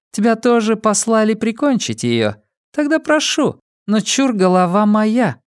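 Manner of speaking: high-pitched offer with a claim on the prize; villainess's challenge. A narrator's reading. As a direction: taunt a rival in a high, put-on voice. Villainess addresses the Shrike in dialogue.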